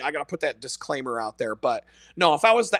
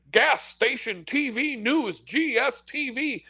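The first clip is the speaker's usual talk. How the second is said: mock announcer; 8 semitones higher, slower than usual. Mocking the channel's announcer.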